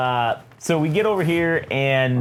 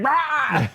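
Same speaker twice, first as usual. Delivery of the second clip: high-pitched comic yelp of alarm; mock scream; 11 semitones higher than usual. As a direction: imitate a villain caught in a blast. Bomb blows up Stilt Man, acted.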